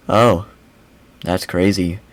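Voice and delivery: low, quiet, flat imitation; deadpan, with zero reaction; mocking monotone. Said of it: "in that monotone of a voice".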